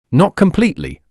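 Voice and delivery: sharp cry. Acted. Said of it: "cried Pestovich".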